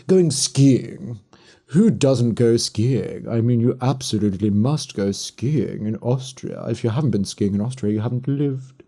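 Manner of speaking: upper-class RP with a lofty attitude; snooty received pronunciation; posh RP accent. Shown as a demonstration. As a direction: play the oblivious Oxbridge toff. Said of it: "That's proper R P".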